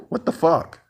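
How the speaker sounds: high, disbelieving what the fuck; sudden high-pitched indignation; incredulous outburst. Real, not acted. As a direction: spit it out in sheer disbelief. Incredulous outburst mid rant.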